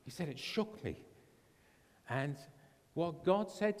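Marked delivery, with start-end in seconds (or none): 0.3-1.0 higher imitated German voice; German accent. Missionary repeating his reaction, higher German accent. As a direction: lift it in a mock German accent. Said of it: "in the same German voice".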